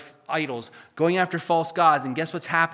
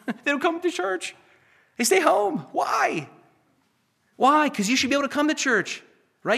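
pleading with the flock, voice way up and laughing into it; high-pitched impassioned preaching; 10 semitones higher, 4 dB louder than usual. Acted. Preacher to churchgoers who skip church, high urgent pleading. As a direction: preach it high and urgent, almost shouting the whys.

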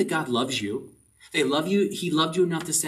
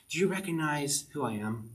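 ordinary talk, nothing marked

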